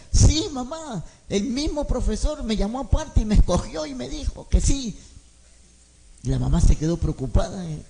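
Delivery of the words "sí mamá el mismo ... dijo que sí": put-on insistent child. Niño insiste feliz.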